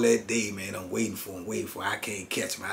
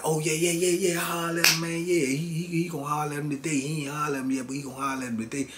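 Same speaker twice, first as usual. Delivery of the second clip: raised, quick-talking eager friend; eager excuses; 5 semitones higher, much faster than usual. Retold or imitated. Friend assures the narrator he will holler today, pitched up and eager.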